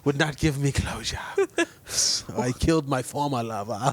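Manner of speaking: put-on light French-accented killer confession; lightly voiced French lover, laughing; mock French accent. Still joking with co-host, in light mock French. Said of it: "continuing French bit".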